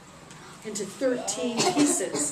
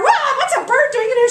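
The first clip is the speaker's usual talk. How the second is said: high, loud alarmed cry; startled shriek; 14 semitones higher, 12 dB louder than usual. Acted. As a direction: yelp at the bird in shock. Queen startled by the bird.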